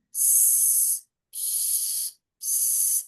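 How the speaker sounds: soft, long hissing rustle; rustling leaves sound. A narrator's reading. Storyteller imitates leaves moving in the forest, soft hissing. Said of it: "That was the only sound he could hear".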